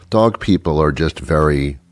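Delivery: ordinary talk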